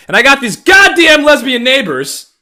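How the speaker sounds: sudden high, loud outburst; exasperated yell. Real, not acted. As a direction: erupt in exasperation. Rant erupts about neighbors.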